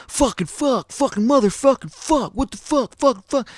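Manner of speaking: high-pitched frantic cursing fit, acted out; background cursing. Storyteller imitates the dude swearing in the background, high frantic cursing. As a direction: mimic a guy swearing his head off, pitch way up. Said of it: "I can hear the dude in the background".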